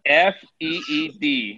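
F E E D spelled letter by letter, slowly. Shown as a demonstration. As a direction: spell F E E D slowly so nobody mishears. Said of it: "Did you say he would beat you or feed you".

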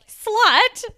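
loud, high, jeering one-word jab; drunken insult. Retold or imitated. Ellie, drunk, loudly insults Caitlyn before walking off. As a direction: fling the word like an accusation.

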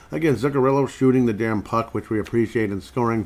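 normal talking voice

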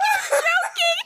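very high, squealing laugh; can't stop laughing. Real, not acted. Quoting mom's disbelief while laughing hard.

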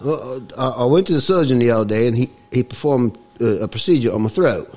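comic mock-Cockney accent; put-on Cockney accent. Guest explains his voice to the host, put-on Cockney accent. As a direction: talk in a hammed-up Cockney accent. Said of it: "it's a little bit Cockney".

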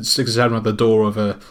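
ordinary delivery

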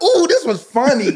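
slow, laughing mock delight. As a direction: savor it like a fan. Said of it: "I'll be like".

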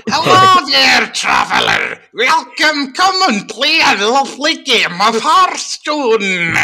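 loud, slow, high mock Scottish dwarf innkeeper; bad Scottish accent. Innkeeper greeting players, bad loud Scottish accent. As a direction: ham up a badly faked Scottish welcome. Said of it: "with a Scottish accent hired by someone who".

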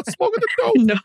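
words squeezed out through laughter; laughing. Real, not acted. Teasing a friend about dope, laughing.

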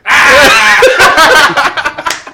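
high loud burst of laughter. Real, not acted. Telling the mushroom story, loud laughter. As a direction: crack up hard.

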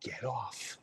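very soft menacing order; soft quiet threat; low threatening whisper. Retold or imitated. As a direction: whisper the command, deadly calm. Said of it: "And he goes".

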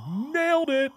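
high, far-off voice of cocky triumph; a high mock victory cry; smug self-congratulation. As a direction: crow it like you just won. Smug self-quote after bad interview.

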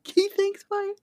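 mocking echo. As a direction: quietly mimic the breezy sign-off. Echoing Hank's text mockingly.

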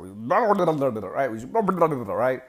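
slow nonsense gabble spilling words; garbled babbling. Shown as a demonstration. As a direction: babble on like spilling your guts. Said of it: "Sorry if that made, made it a little bit annoying".

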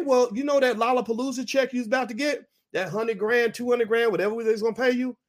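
high, sing-song taunting of a rapper who thinks he's grown; mocking taunt. The industry taunting a defiant rapper, mocking voice. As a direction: sneer like you're yanking his check away. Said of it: "they said".

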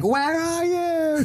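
very high, slow horror movie wail; mock the scared girl calling out to the killer; Scary Movie victim. Horror movie girl calls to the killer: high, slow wail. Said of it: "she's like".

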